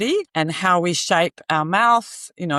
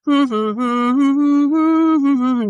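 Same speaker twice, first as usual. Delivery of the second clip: simple, sustained hum, higher and louder; humming; 7 semitones higher, 5 dB louder than usual. Shown as a demonstration. Showing listeners how simple singing can be, humming. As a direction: hum a loose little tune. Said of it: "as simple as a hum".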